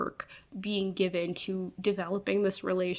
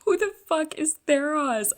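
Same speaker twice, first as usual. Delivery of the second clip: loud, higher incredulous outburst; baffled outburst; 7 semitones higher, 7 dB louder than usual. Real, not acted. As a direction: blurt it out in disbelief. Her baffled reaction to reveal.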